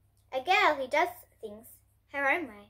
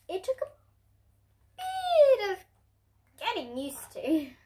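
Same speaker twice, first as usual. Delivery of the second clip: wobbly high squeak; 8 semitones higher, slower than usual. Acted. Narrator acting out the wobble, high squeak. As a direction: squeak it like losing your balance.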